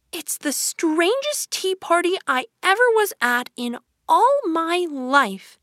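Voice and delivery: put-on high girl voice, slowing to the end; Alice concluding. Frustrated Alice leaving the tea party, high and emphatic. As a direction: pronounce the verdict high, drawing out the ending.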